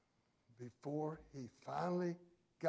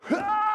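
sudden high, loud wail of "ah"; loud crying sigh; 15 dB louder than usual. Shown as a demonstration. Preacher imitating Jesus's last cry, loud wailing sigh. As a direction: imitate a great cry of release. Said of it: "he cried out in a li-, loud sigh, a loud".

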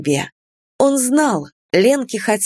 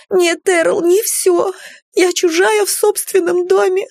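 wailing in distress; panicked wail; 11 semitones higher than usual. A narrator's reading. Sukie panics at Earl.

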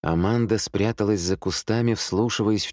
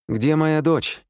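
high-pitched anxious question; urgent demand; 9 semitones higher, 4 dB louder, slower than usual. A narrator's reading. Mother asks the sergeant about her daughter, urgently.

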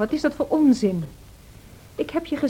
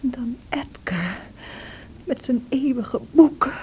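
ordinary talk

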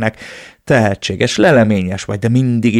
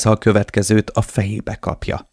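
ordinary delivery

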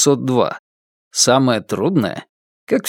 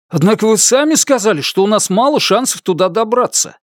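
higher, quicker protest; objecting voice; 8 semitones higher than usual. A narrator's reading. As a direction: push back, a bit heated. (возражающий голос)

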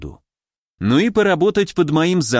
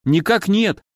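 higher-pitched, startled denial; frightened exclamation; 6 semitones higher than usual. A narrator's reading. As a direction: blurt it out like a scared subordinate. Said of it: "воскликнул испуганный парень". (испуганно)

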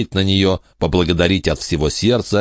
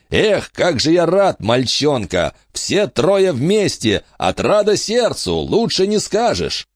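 hearty gnome king; 6 semitones higher than usual. Acted.

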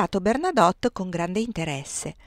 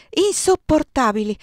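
louder, higher, the word bursting out; distressed outburst; 7 semitones higher, 6 dB louder than usual. A narrator's reading. A young woman overwhelmed at the table, distressed outburst. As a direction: let insopportabili break out in distress.

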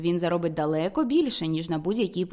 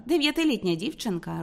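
ordinary delivery, nothing marked

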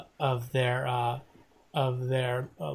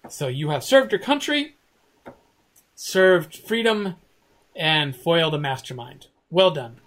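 ceremonial praise; 3 semitones higher, 5 dB louder than usual. Real, not acted. GM concluding praise.

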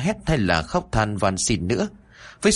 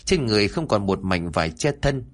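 plain reading voice

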